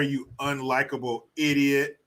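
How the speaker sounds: normal talking voice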